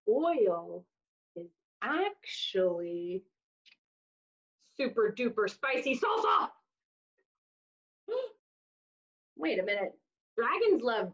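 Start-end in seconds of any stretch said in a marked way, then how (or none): none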